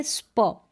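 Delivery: "स्प" as one joined sound; blended sound. Shown as a demonstration. Teacher showing two letters read together, blended. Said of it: "हम एक साथ पढ़ेंगे".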